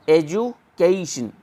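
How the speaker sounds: एजुकेशन slowly, "edju" then a long "kay-shun"; slow sounding-out. Shown as a demonstration. Teacher shows where the diphthong sits, slow sounding-out. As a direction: stretch एजुकेशन in two careful parts. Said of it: "stress रहता है".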